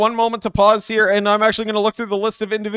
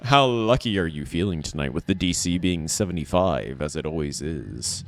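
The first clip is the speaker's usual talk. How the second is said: much lower, softer menacing question; dramatic gambler; 13 semitones lower, 8 dB quieter than usual. Acted. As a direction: loom over them like a dice villain. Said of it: "I need to ask you this question".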